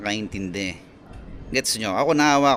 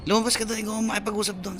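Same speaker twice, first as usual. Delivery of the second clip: mimicking yelling parent; 8 semitones higher, 5 dB quieter, faster than usual. Parent yelling at child, retold.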